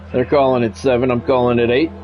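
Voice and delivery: normal talking voice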